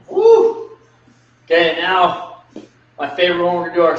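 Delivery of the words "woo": excited whoop. Real, not acted. Cheer after finishing count.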